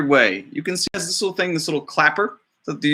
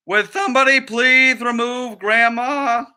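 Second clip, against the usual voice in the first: higher, put-upon request; weary director; 8 semitones higher, slower than usual. Acted. The director asking crew to remove grandma, weary. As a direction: ask the crew to drag her off.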